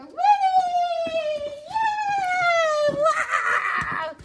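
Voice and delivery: very high, loud happy shout; excited recall. Shown as a demonstration. Trainer calling Winnie for a recall, high excited shout. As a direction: whip the dog into excitement. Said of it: "So I get him excited".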